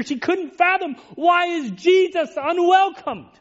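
fervent sermon delivery; impassioned preaching. Acted. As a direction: preach it out with fervour, climbing. Preacher's anguished climax.

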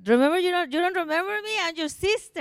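high-pitched retold disbelief; incredulous reunion. Long-absent sister to sister who doesn't recognize her, high incredulous retelling. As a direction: re-enact pleading to be recognized, voice way up.

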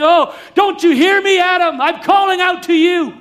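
high, loud yearning call; loving father calling. Acted. Preacher voicing a loving father calling Adam, loud and high. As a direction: reach out with a father's longing. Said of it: "that love of a father saying".

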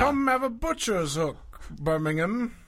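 hammed-up Cockney Bane impression. Riffing on Tom Hardy, a Cockney Bane voice. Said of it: "Cockney and Bane sound like".

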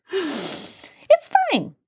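high-pitched mock-chipper "It's fine"; squeaky voice. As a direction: squeak it out, forced cheer. High squeaky self-reassurance.

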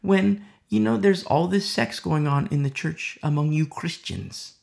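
a scornful outsider's voice, pitch jumping up on the questions; hammed-up sneer at you Christians; mocking non-believer. Preacher voicing a scoffing non-believer to the congregation, mocking.

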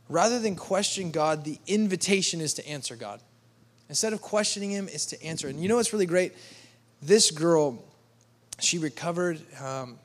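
usual speaking voice

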